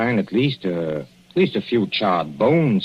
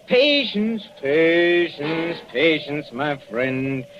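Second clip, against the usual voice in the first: high, sing-song taunting; mocking patience; 5 semitones higher, much slower than usual. Villain taunting bound doctor, sing-song mockery. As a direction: tease him, drawing each word out.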